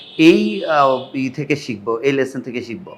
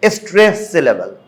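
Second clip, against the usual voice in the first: normal talking voice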